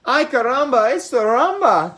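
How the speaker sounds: high, loud and gleeful; cheering. Acted. Chihuahuas joining the dance, loud high cheer. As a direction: cheer it like a party starting. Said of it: "cheered the chimichangos".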